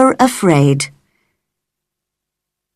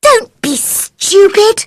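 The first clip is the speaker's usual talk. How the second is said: angry retort; slower than usual. A narrator's reading. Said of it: "cried Mary".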